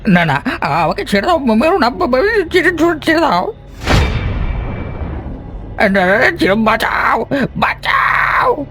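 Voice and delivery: very high stammering panic; terrified pleading. Acted. Traveller begging the ghost to let go, shrieking terror. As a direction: beg for life, breaking into screams. Victim begs for life.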